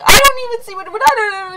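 high, loud mock wail of the sister; imitated bawling. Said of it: "She just started bawling".

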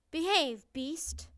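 loud, stern scolding order; stern command. A narrator's reading. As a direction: bring a beast to heel. Princess orders monster to behave.

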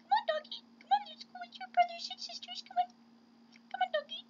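squeaky, very high coaxing; high pet-talk voice. Pretend. Player coaxing found dog home, squeaky pet talk. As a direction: coo to a pet way up in falsetto.

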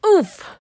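high, loud impact grunt; cartoon crash grunt; pained oof. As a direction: get the wind knocked out. Crashing through the ceiling.

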